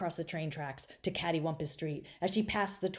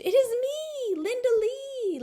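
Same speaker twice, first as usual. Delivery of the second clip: very high, loud pleading; girl begging grandma to know her; 5 dB louder, slower than usual. A narrator's reading. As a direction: insist she is herself. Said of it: "Linda Lee said".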